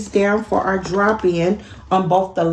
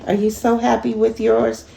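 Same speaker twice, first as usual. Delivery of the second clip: usual speaking voice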